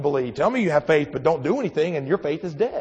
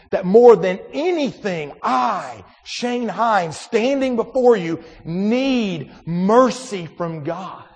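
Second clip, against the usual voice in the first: preaching build; 3 semitones higher, 3 dB louder, much slower than usual. Acted. Preaching climax.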